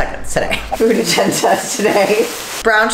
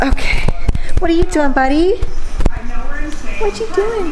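high sing-song voice for a pet; talking to a dog; 9 semitones higher than usual. Real, not acted. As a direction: coo at the puppy, higher.

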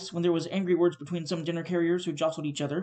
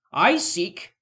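loud affronted "I seek!"; indignant exclaim; 2 semitones higher, 6 dB louder than usual. Acted. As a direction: throw the words back at him. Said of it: "exclaimed the spirit".

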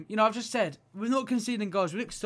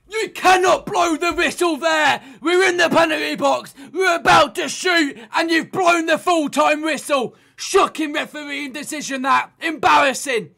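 furious rant; 9 semitones higher, 11 dB louder than usual. Real, not acted. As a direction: rage at the referee's whistle. Ref blows whistle during attack.

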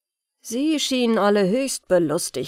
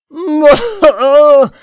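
big booming royal guffaw; roaring laugh; 7 semitones higher, 16 dB louder than usual. Acted. The king roars with laughter at the dwarf. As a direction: bellow a cruel laugh. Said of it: "brüllte lachend der König".

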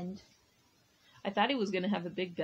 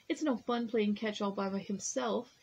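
plain reading voice

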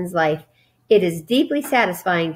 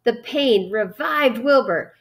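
ordinary delivery

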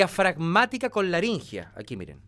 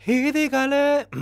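sung higher and slow with air in the voice; breathy sung phrase; airy voice; 6 semitones higher, 4 dB louder than usual. Shown as a demonstration. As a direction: let air into the voice. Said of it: "de voz con aire".

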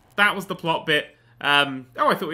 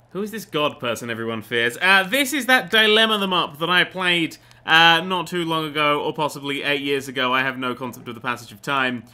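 ordinary talk

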